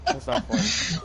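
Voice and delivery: high, loud laugh-through protest; laughing so hard the words squeak out. Real, not acted. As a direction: protest while cracking up.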